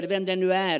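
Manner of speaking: ordinary talk, nothing marked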